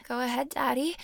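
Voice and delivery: normal talking voice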